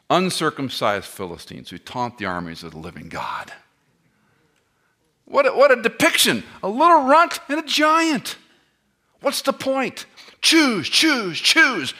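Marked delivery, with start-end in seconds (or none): 5.3-11.9 much higher, louder, fervent preaching; worked up. Real, not acted. Preacher getting worked up about the runt and the giant, shouting high. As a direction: preach it at full force. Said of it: "I get a little worked up".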